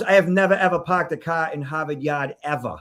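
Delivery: put-on thick Boston accent, r's dropped in parked and Harvard Yard. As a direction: deliver the classic Boston line with the r's dropped. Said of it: "Oh, that was well placed".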